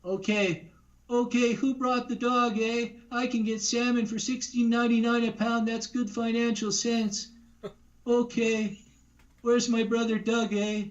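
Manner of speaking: a fussy nerd fretting; Rick Moranis impression. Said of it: "Rick Moranis from Ghostbusters".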